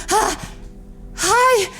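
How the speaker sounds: high-pitched mock pleading; desperate begging. Traveler begging gate attendants, high desperate plea. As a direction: beg in a small, high, panicked voice.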